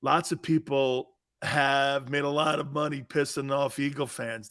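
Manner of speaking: ordinary talk, nothing marked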